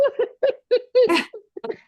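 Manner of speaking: high laugh through tears; tearful laugh. Real, not acted. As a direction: release the emotion in a laugh.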